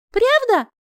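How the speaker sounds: high, surprised tipsy squeak; drunk hiccup question. Acted. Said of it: "пьяно икнула я".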